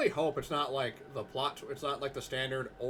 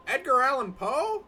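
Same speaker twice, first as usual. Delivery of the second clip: higher, louder, slow surprise; stunned at the name; real delighted surprise; surprised; 11 semitones higher, 8 dB louder than usual. Real, not acted.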